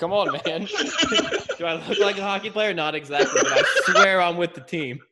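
play-pleading with a doorman, cracking up; laughing mock plea. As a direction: plead jokingly through laughter. Jokey pleading with doorman, laughing throughout.

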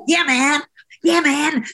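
high-pitched attempt at an American accent. Shown as a demonstration. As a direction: try an exaggerated American accent, breaking toward laughter. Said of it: "trying to do the American accent".

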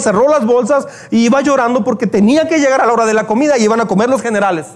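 ordinary talk, nothing marked

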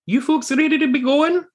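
high squeaky voice of the odd companion; Talks to herself voice. Acted. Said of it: "she says".

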